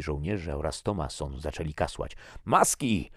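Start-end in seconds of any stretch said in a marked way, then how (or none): 2.4-3.1 one loud, high shouted word; roared warning. A narrator's reading. Man warns coughing soldiers about pollen, roaring. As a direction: roar it as an urgent command. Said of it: "ryknął Tomasz".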